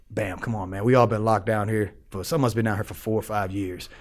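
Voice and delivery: low, soft coaxing; quiet mafia boss. Retold or imitated. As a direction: imitate the old boss's hushed request. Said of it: "with the quiet voice".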